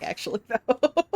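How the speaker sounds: ordinary talk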